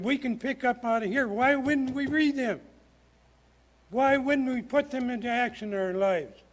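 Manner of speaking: pulpit-pounding plea; fired-up preaching. Real, not acted. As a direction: press the congregation hard with each why. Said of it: "I'm pounding on the lectern".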